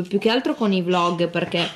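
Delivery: ordinary talk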